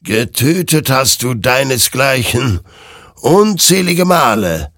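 loud, high, emphatic reproach; accusing. Acted. Creature confronts Hector with his killings, loud accusation. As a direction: hurl the accusation out. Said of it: "warf die Kreatur ihm vor".

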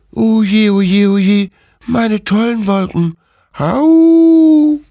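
loud, high puppy lament ending in a howl; wailing puppy Bruno. A narrator's reading. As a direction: wail like a heartbroken puppy. Bruno wails over his clouds. (laut jammernder Welpe mit Jaulen)

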